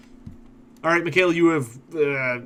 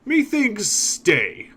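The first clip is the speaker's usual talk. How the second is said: slow, content ogre drawl, rising at the end; much slower than usual. Acted. Ogre refusing a job offer, slow and content. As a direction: lay out the perks like a satisfied brute. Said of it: "shrugs and says".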